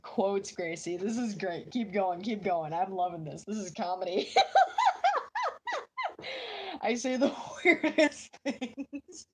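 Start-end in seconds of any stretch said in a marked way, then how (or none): 4.3-6.8 high shrieking laugh. Real, not acted. Laughing at own joke. 6.8-9.3 words broken by high slow laughter; real giggling fit, slow and high; laughing. Real, not acted. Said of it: "I shouldn't be laughing that much".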